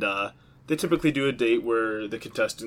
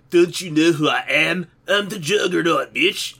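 higher, hammed-up Scottish accent; bad Scottish accent; 5 semitones higher, 4 dB louder than usual. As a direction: do a badly faked Scottish brogue, pitched up. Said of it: "that's my bad version of a Scottish accent".

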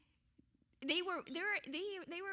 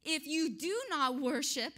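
loud warning building to a boom; growing louder, stretching out the final words; emphatic royal threat; 2 semitones higher, 9 dB louder than usual. Acted. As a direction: punch it home as the threatening king. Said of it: "He's making sure you understand".

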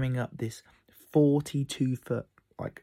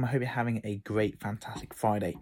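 normal talking voice

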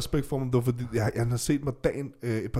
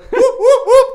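whooping cheer; 15 dB louder, slower than usual. Retold or imitated. Partying cheer.

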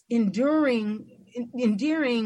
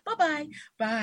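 higher-pitched imitation of airline goodbyes; stewardess farewell; 5 semitones higher than usual. Stewardess at the airplane door, imitated high farewell. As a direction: mimic the stewardess's rote farewell. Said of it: "how the stewardess says when you leave the airplane".

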